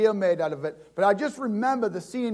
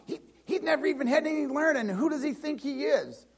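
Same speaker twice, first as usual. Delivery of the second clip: mocking, indignant sneer of the rabbis; scoffing leaders; 8 semitones higher than usual. Leaders scorning the unschooled Jesus, a scoffing sneer. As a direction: sneer it like the offended religious leaders.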